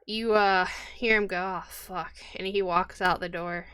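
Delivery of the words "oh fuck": much softer muttered curse. Acted. Husband mutters a curse heading out into the wind, softer. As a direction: mutter it under your breath.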